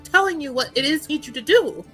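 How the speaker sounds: normal talking voice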